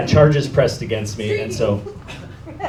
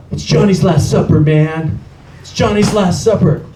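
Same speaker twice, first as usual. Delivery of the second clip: retold distressed cry, louder and higher; upset caller; 5 semitones higher, 3 dB louder than usual. Upset Johnny calls his friend from jail or home, distressed. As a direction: imitate an upset friend on the phone. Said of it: "he sounds very upset".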